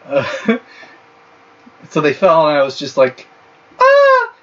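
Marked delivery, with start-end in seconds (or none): 3.8-4.3 high-pitched girly fake scream; playful high shriek, hammed up. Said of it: "Like, just fake screaming".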